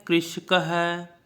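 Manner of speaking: कृषक है spoken very slowly; slow, clear कृषक है; slow model reading. Shown as a demonstration. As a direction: draw out कृषक है for pupils to repeat. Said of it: "करिए आप साथ में उच्चारण".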